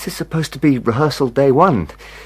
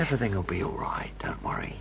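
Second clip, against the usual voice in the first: soft reassurance; 5 semitones lower, 12 dB quieter than usual. Acted. Comforting the crying woman.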